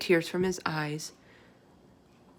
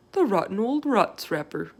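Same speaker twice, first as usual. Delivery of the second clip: indignant cry; 2 semitones higher, 6 dB louder than usual. Acted. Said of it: "cried the B F G".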